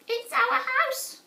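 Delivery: very high squeaky mother mouse. Acted. Narrator voicing the mother mouse to George, very high and squeaky. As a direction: squeak it out like a tiny worried mouse. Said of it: "squeaked the mother mouse".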